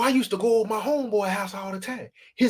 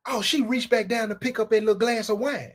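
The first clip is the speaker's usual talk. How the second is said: ordinary talk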